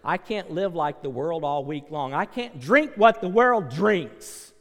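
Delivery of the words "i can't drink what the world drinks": loud, driving preacher's voice; full-force preaching. Real, not acted. Preacher urging church to save the drowning, full force. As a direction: hammer it home to the pews.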